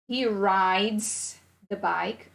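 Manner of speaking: very slow, drawn out "he rides the bike"; slow model sentence. Shown as a demonstration. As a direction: stretch each word slowly. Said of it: "teacher modelling sentence".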